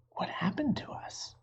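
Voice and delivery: soft, breathless whisper, stunned; dazed whisper. A narrator's reading. Dazed Jack asking Annie what happened, whispered. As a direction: barely breathe it out in wonder. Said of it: "Jack still felt dazed".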